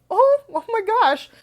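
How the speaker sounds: high, slow gasp of delighted surprise; gushing surprise. Retold or imitated. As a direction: replay the moment the gift melted her heart. Retelling her reaction to gift.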